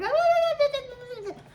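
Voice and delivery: very high, loud run of squeals; rapid imitated baby shrieks; mimicked toddler protest. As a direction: screech like a toddler whose toy was taken. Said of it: "like, she'd be like".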